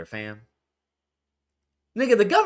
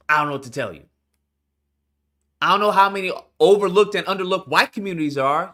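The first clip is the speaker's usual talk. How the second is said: ordinary delivery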